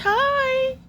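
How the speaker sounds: very high, thrilled little squeal; delighted greeting. Real, not acted. Amazed a bird flew right in, delighted greeting. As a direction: squeal hello to a cute animal.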